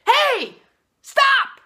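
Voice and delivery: sharp, very loud high shout to stop a fight; loud yell. Retold or imitated. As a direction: bellow it across the subway car to freeze everyone. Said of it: "yelled very loudly".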